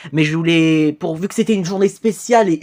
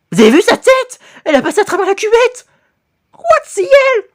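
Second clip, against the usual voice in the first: shocked high exclamation; 13 semitones higher, 4 dB louder, faster than usual. Real, not acted. Reacting to the video in shock.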